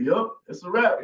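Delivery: usual speaking voice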